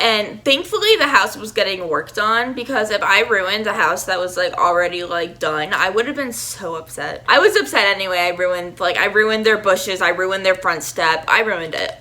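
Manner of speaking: normal talking voice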